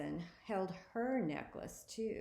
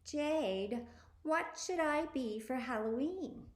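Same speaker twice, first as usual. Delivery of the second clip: high-pitched, squeaky and annoying; whiny older sister squeak; 7 semitones higher than usual. A narrator's reading. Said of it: "Her voice sounded like a squeaky, high-pitched, annoying voice".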